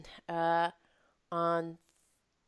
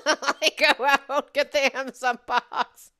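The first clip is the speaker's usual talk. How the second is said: laughing; 8 semitones higher, 9 dB louder than usual. Real, not acted. Laughing through frantic retelling.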